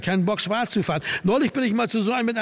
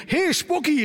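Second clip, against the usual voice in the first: calling out; 9 semitones higher, slower than usual. Acted. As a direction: hail a friend on stage by name. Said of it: "rief er".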